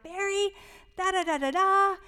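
imitating her own aggravated rant; frustrated wife. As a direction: vent like a nagging wife, hammed up. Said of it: "I would be so frustrated and aggravated".

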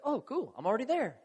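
high-pitched, joking listener reply; mock nonchalant approval. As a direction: chime in as a listener who's already on board. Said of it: "Some of you are like".

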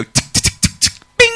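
clicky ticks, then a ringing bing; typewriter imitation. Comic showing what is heard nightly, imitated typewriter. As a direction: imitate keys tapping and the bell.

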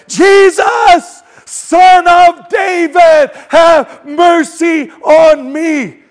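full high shout, long drawn-out words; all-out shouted plea for mercy. Retold or imitated. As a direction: bellow it slowly over the crowd. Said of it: "He gets louder and louder".

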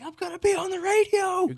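high, thrilled squeal; excited. Retold or imitated. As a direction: squeal it like a giddy kid. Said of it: "I was like".

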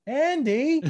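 high-pitched, drawn-out Andy; mock high call; mimicked call of Andy. Mimics a character calling Andy.

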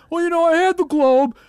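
very high, loud whiny complaint; mock angry customer. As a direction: whine like a fed-up subscriber. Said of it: "lying your fucking ass off to be like".

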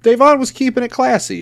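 high, loud sarcastic praise. Real, not acted. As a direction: say it with a mocking sneer. Mock praise after fight.

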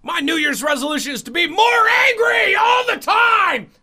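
high screaming comic rant; hammed-up screeching outburst; Sam Kinison. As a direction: scream it in mock rage, stretching the last words. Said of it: "it's like you're channeling Sam Kinison".

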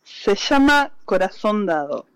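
usual speaking voice

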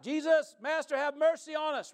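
loud, high cry for mercy; yelling out. Retold or imitated. Ten lepers begging Jesus for mercy, yelling. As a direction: yell it pleadingly across a distance. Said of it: "they're yelling this out".

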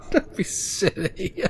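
high, slow laughing dismissal; words bubbling up through high laughter; amused halfling father. Acted. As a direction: laugh off a silly idea. Father laughs off being dead.

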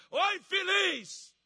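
high, loud mock scolding shout; exasperated rebuke. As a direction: bawl out a hopeless believer, stretching the insult. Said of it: "se fosse eu falar assim".

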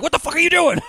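mock yell. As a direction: yell at the wife, hammed-up. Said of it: "starts yelling at her".

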